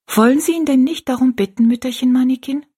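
put-on high, artless child's voice; simple question. A narrator's reading. Rosalie asks old woman to pray, high simple question. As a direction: ask plainly, like a little girl. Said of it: "fragte Rosalie einfach".

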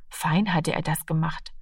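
ordinary delivery, nothing marked

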